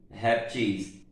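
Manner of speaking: "reptis" stressed on the final syllable TIS; last-syllable stress on "reptis"; oxytone stress. Shown as a demonstration. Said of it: "a mesma coisa".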